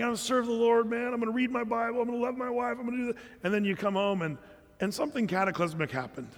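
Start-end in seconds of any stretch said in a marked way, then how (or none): 0.0-3.2 higher, eager string of vows; fired up. Retold or imitated. Man home from a retreat, vowing eagerly. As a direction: act out post-retreat zeal. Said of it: "you're all fired up".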